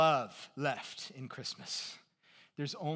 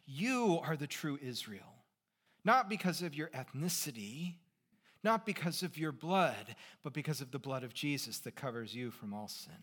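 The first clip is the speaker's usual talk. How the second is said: ordinary talk, nothing marked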